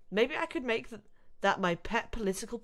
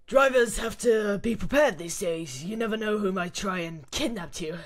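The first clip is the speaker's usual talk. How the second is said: Krauser voice; 2 semitones higher, 6 dB louder than usual. Acted. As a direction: growl it like a brute mercenary. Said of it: "the Krauser voice".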